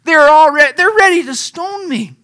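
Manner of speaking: loud, desperate cry to the Lord. Acted. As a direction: shout it up to heaven in despair. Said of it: "Moses cried out".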